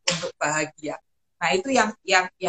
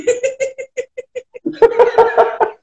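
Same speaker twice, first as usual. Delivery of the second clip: real high-pitched giggling fit; long laugh; 8 dB louder than usual. Real, not acted. Guest amused by her own isolation answer, real long laughter. Laughs after naming the key moment.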